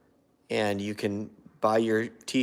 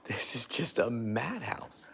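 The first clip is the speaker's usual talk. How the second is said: whispered; 7 dB quieter than usual. Acted. Callers thinking it's a madhouse.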